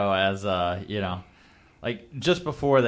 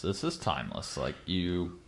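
said much lower and softer; a softer, lower echo of the same line; low aside; 7 semitones lower, 10 dB quieter than usual. Shown as a demonstration. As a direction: drop it low and quiet, to yourself. Line repeated, second take dropped.

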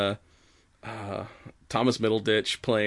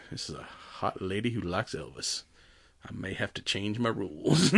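1 lowered smitten Tallahassee; 3 semitones lower, 9 dB quieter than usual. Retold or imitated.

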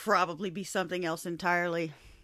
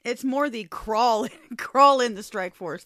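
slow, loud, laughing mock ad line; laughing imitation; 5 semitones higher, 11 dB louder, slower than usual. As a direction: drag it out through laughter. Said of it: "That's the part that got to me".

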